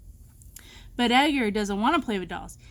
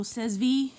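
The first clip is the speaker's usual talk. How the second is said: plain reading voice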